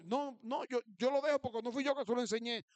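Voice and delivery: quick, high-pitched mock self-justification; defensive parent. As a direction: mimic a parent hurrying out an excuse. Acted excuse of lax parent.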